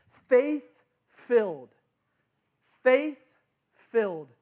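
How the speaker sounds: stretched out slowly, each word weighed; slow emphatic repeat. Acted. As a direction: let faith-filled hang slowly. Preacher stressing key phrase.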